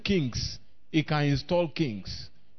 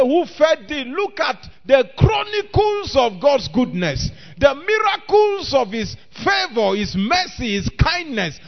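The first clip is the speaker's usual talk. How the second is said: loud, high, emphatic preacher; full-force preaching; 5 semitones higher, 8 dB louder than usual. Acted. Preacher proclaiming God's goodness to the congregation, full-force preaching. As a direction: drive each phrase out to the whole church.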